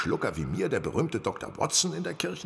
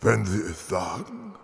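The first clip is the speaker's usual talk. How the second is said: disguised working man; 10 semitones higher than usual. Acted. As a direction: play the humble simple man, unhurried. Holmes still in Miller disguise.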